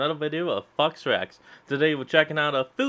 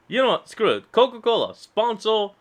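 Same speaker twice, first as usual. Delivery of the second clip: excited; 8 semitones higher, 4 dB louder than usual. Real, not acted. Begging Coca-Cola for sponsorship.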